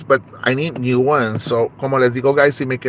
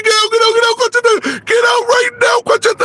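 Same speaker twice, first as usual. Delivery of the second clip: very high, loud repeated yell; screaming; 5 dB louder than usual. Acted. The in-law screaming Conchita out again and again. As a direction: keep screaming get out. Shouting eviction scene.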